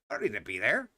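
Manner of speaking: same words dropped low and soft, flat finality; low deadpan repeat. Retold or imitated. Repeating the excuse to a friend, low and soft deadpan. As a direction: mutter it low like the matter's closed.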